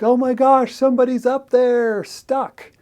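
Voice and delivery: high, loud panicked gasp of alarm; alarmed hiker. Retold or imitated. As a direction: sound the alarm about someone stuck. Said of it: "and they're like".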